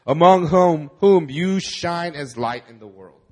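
usual speaking voice

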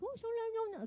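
high, Chinese-sounding mock tongues; high-pitched imitation of praying in tongues; imitated tongues. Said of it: "listen to my tongues".

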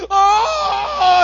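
long, very high, loud squeal; hammed-up delighted scream; excited child's shriek. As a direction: imitate a kid screaming with joy. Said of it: "He gets so excited".

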